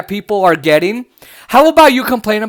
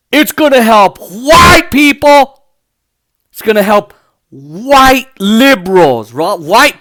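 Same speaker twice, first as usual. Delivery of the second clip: mock shout; 4 dB louder, slower than usual. Mocking the culture war line.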